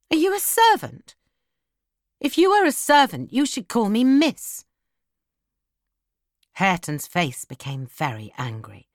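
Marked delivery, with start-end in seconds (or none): 0.1-4.6 high, imperious demand; haughty challenge. Acted. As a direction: put him in his place. Haughty girl challenges supposed servant.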